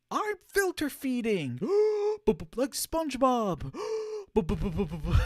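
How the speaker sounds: high, loud exclamations with noisy gasps and bubbling; hammed-up high cartoon voice, stuttered bubbling noises; silly cartoon bit. Streamer pretending to filter feed like SpongeBob, silly and loud. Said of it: "or go like".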